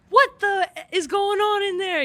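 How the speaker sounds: high, loud, slow angry yell; angry coach yelling. Retold or imitated. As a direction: shout through the door like a mad coach. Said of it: "coaches are knocking on the door".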